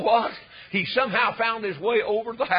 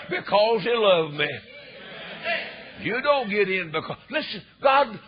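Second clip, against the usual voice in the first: ordinary talk, nothing marked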